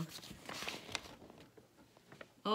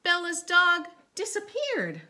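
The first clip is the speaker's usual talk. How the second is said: dramatic reveal; 9 semitones higher, 9 dB louder than usual. Acted. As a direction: make the vanishing sound shocking for kids.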